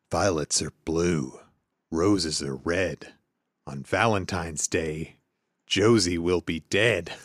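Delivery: low, slow menacing stalker; scary voice. Acted. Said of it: "Just do a scary voice".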